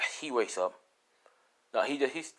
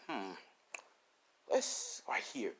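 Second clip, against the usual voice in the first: sneaky whisper; 9 dB quieter, slower than usual. Acted. Entity hiding Deku, sneaky.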